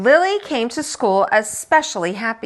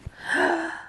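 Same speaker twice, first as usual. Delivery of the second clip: sharp little gasp; shocked gasp; 7 semitones higher, 7 dB quieter than usual. Acted. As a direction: gasp at her nerve. Shocked by Lily.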